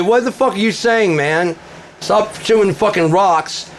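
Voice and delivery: high, loud taunting rant; mocking yell. Shouting back at the troll in his game room. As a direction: yell the insult back at him.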